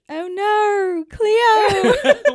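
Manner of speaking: high, loud squealed quote; quoted catchphrase. Retold or imitated. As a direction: squeal it like a cartoon line. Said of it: "The only thing I know how to say".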